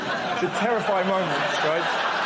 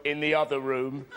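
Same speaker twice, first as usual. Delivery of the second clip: a hint of a booming doctor; low, unhurried doctor's order; mild booming doctor; 5 semitones lower than usual. Doctor telling the patient where to go, lightly booming. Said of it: "his booming voice go".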